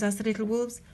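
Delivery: ordinary delivery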